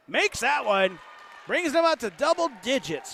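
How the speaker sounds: rising commentator shout; excited call. Real, not acted. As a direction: belt it out as the shot drops. Calling a made three live.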